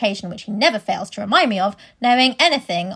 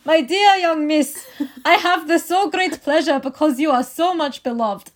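put-on slow, loud German accent; 4 semitones higher, 5 dB louder than usual. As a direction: read it with a heavy German accent. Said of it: "I only have a German one".

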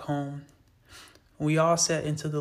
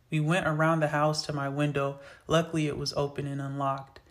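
usual speaking voice

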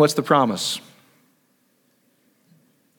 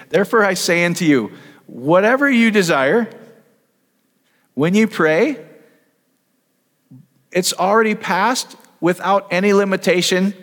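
fervent preaching; 7 semitones higher than usual. Acted. Preacher voicing Jesus to the congregation, fervent and higher. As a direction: proclaim it as Jesus, lifting each line.

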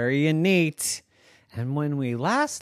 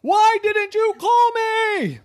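much higher, louder yell; shouting kid; 7 dB louder than usual. Acted. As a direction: yell it down the phone in frustration. Alex yells down the phone.

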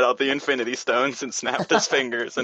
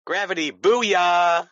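triumphant; 6 semitones higher, 4 dB louder, much slower than usual. Acted. Newton triumphant.

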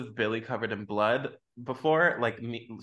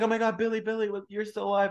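mock relief; 7 semitones higher, faster than usual. Voices Sidney finding Billy alive.

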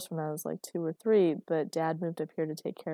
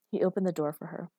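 ordinary delivery, nothing marked

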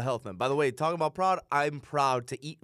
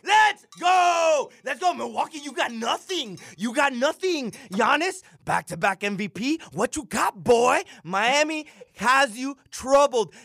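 hype trash talk; 9 semitones higher, 5 dB louder, much slower than usual. Acted.

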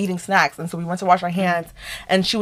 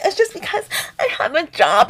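high tearful whine, mimicked; mock crying; 10 semitones higher than usual. Mimicking crying roommate blaming work study, mock tearful whine. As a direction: whimper the excuse in a mocking squeal. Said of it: "she was crying and crying and crying".

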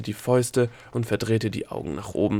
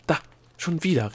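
higher, exasperated cry; exclaimed; 6 semitones higher than usual. A narrator's reading. Delia protesting the gnome's interruption, exclaiming. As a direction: call out in protest. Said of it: "rief Delia".

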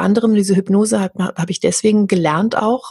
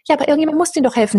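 higher, fast mock protest; mock objection; 8 semitones higher, much faster than usual. Parodying helpers who insist on rescuing others, higher and fast. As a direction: rattle off the imagined objection.

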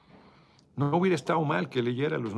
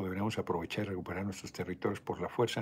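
ordinary delivery

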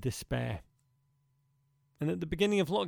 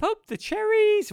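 cheer; 8 dB louder than usual. Acted. Cheering Bournemouth among relegation teams.

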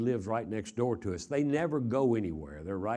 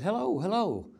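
sharply higher, worried calling into the phone; anxious hello; 10 semitones higher than usual. Retold or imitated. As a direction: call out, alarmed, not sure anyone's there. Still no answer on phone.

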